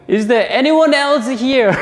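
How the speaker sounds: high, loud call for anyone else; desperate shout. Retold or imitated. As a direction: shout it out high, hoping someone else answers. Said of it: "again shout".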